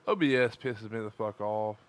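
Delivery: ordinary talk, nothing marked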